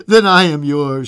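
the same words re-read loud and plain. Acted. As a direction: say it out, firm and full-voiced. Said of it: "she said again".

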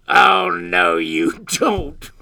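loud creature refusal; fly-by-night crying out. A narrator's reading. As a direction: shout it down as the bossy creature. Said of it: "cried the fly-by-night".

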